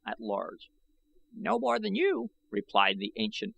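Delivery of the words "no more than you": put-on high, reedy old man's voice, boastful. A narrator's reading. Old I Gos gloating to the young warriors, high and reedy. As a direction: crow about it like a proud old man.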